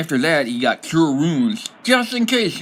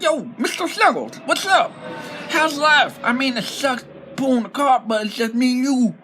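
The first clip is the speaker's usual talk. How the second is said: silly goblin voice; 11 semitones higher than usual. Acted. Lonely goblin merchant chatting to his mule, high goofy voice. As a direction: greet the mule like an old pal. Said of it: "unable to do the silly voice".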